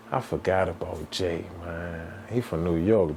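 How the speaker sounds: usual speaking voice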